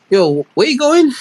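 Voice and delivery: high, drawn-out questioning; suspicious mum. Retold or imitated. Son retelling mum catching him at the door, suspicious. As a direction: imitate a mum stopping her child in their tracks.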